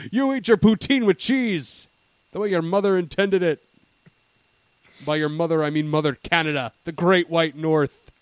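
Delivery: hammed-up Canadian tirade building loud; Canadian server. Canadian server orders him to eat cheese, building mock outrage. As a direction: rant like a patriot defending poutine. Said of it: "they'd be like".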